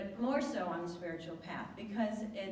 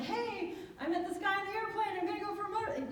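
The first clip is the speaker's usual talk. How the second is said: high, fast, giddy telling; excited squeal; 13 semitones higher, faster than usual. Retold or imitated. Telling Tamara about the guy from the plane, high and giddy. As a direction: gush to your best friend about the guy.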